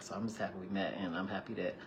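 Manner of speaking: a nervous man confessing to her over lunch; soft and vulnerable. Retold or imitated. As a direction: confess it shyly, barely above a hush. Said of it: "He was very vulnerable".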